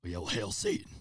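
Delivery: low, hesitant villain attempt; badly faked menacing killer; scary-voice retry. Shown as a demonstration. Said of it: "Let me try it again".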